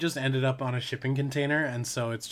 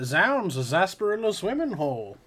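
higher, louder vintage radio voice; old-timey announcer; 6 semitones higher, 4 dB louder than usual. Acted. Host copying the transatlantic accent, old-timey announcer voice. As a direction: exclaim it like an old broadcast. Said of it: "the announcer voice".

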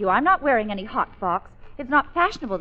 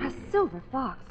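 hushed realisation; 6 dB quieter than usual. Acted. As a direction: softly echo it to yourself. Claire repeats it, sinking in.

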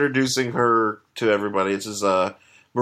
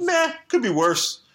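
mock indifferent shrug; 9 semitones higher, slower than usual. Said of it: "So he's probably like".

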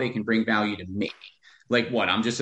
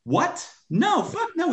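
high, incredulous no, no way; incredulous, high-pitched refusal to a cheeky request; outraged disbelief; slower than usual. Acted. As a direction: shoot the idea down in shocked disbelief.

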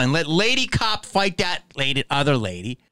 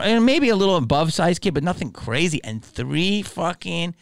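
usual speaking voice

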